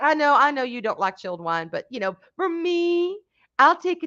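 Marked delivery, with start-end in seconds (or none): none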